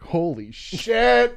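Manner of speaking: high, drawn-out amazed exclamation; mock astonishment. Reacting to her realizing they ate them, drawn-out mock shock. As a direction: stretch it out in high disbelief. Retold shock at having eaten mushrooms.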